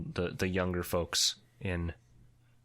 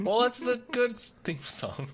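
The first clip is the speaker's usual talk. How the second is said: laughing; 4 dB louder than usual. Real, not acted. Laughing correction of theme song.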